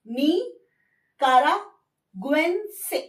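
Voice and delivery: निकारागुएन्से very slowly, gu-en-se clear, rising end; slow syllable drill of निकारागुएन्से; pronunciation drill. Shown as a demonstration. Teacher asks class to repeat, slow. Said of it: "Again बोलिए".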